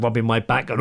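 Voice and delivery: ordinary talk, nothing marked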